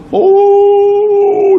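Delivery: loud, high, long howl; mock wail. Pakcik bawling at the Kaabah, imitated loud wail. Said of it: "dia merau".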